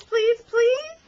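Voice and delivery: pleading. Acted. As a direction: beg to be let in. Begging to be let in.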